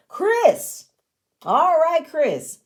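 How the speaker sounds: high, loud, drawn-out name call; big emphatic introduction. Real, not acted. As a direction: sing out the name with relish. Announces the next person's turn.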